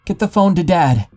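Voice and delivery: high, loud frantic shout; panicked yell. Retold or imitated. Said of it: "I yelled in a panic".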